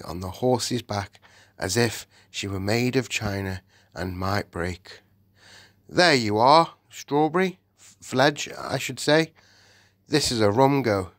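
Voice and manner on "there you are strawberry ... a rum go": higher, hearty Cockney-ish cabby voice; a rough kind cabman talking to his old horse; cheery cabby king. A narrator's reading. New king speaks to horse.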